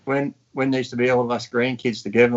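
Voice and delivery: ordinary talk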